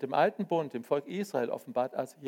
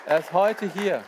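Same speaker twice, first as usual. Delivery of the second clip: louder, raised, stress on heute; emphatic repeat; 4 semitones higher, 7 dB louder than usual. Real, not acted. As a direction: proclaim it louder. Repeated, stronger.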